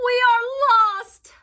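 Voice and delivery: high, loud wail; frightened townspeople wailing; despairing cry. A narrator's reading. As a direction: cry out in fear. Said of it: "said the people".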